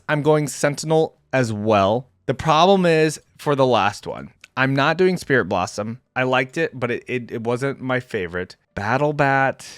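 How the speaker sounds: ordinary talk, nothing marked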